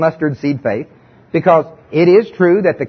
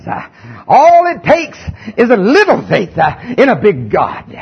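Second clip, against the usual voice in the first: southern preaching voice; 3 semitones higher than usual. Shown as a demonstration. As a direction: belt "a little faith in a big God" like a revival pulpit. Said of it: "I gotta get my southern preaching voice on".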